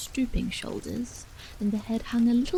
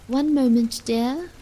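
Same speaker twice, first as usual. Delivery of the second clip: loud call through the door; mother calling loudly to her daughter at the door; 3 semitones higher, 9 dB louder than usual. A narrator's reading. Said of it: "she called".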